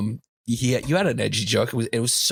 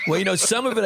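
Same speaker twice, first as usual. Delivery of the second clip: laughing; 7 semitones higher, faster than usual. Real, not acted. Laughing at Russians remark.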